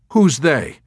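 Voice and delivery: high, loud, suspicious question; suspicious demand. A narrator's reading. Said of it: "Dunbar demanded suspiciously".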